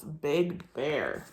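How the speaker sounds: low, soft big bear. Shown as a demonstration. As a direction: drop deep for big bear. Words read to match meaning.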